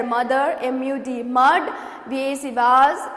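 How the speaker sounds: usual speaking voice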